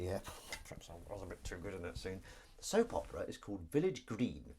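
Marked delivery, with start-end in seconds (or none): 0.0-2.2 soft, low, quick imagined thought; mock-modest aside. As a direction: murmur it quickly, low, as if to yourself. Acting out actor's private thought.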